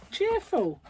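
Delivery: a high, mocking echo of Cheerful; sarcastic echo. Real, not acted. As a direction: repeat Cheerful. as a sarcastic sing-song jab. Echoing word ironically.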